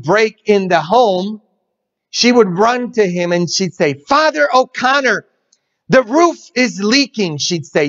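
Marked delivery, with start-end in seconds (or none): 3.9-7.4 high, urgent call to the priest; fussing housekeeper. Retold or imitated. Housekeeper hurries to the priest with a mock fluster. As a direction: run in fretting at Father.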